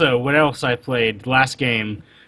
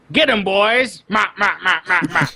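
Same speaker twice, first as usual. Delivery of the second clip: mock Penguin squawk; 3 semitones higher than usual. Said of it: "just be like".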